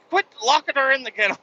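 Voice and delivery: imitated high, fast scolding; scolding wife. Wife telling the daughter off for locking the dog in, high fast nag. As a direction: rattle off a nagging complaint, high. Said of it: "And she's like".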